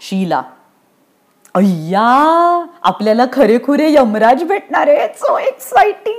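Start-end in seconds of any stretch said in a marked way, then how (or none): 1.5-6.2 drawn-out high squeal; gush, rising into a squeaky squeal; thrilled, very high-pitched girl; girlish excitement. Acted. Girl thrilled to meet Yamraj.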